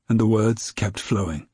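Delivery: ordinary delivery, nothing marked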